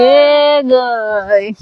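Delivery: long, slow stretched call at normal pitch; drawn-out call. Shown as a demonstration. Grandmother repeats it slowly for the child to echo. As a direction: draw it out for the child to copy. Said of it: "બોલ".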